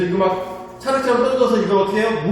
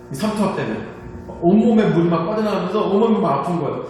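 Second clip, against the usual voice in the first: ordinary talk, nothing marked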